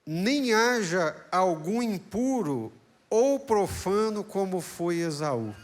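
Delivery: slow, loud, high proclaiming of the verse; preached scripture reading. Acted. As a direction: read the verse like a pulpit proclamation. (leitura bíblica pregada, lenta e forte)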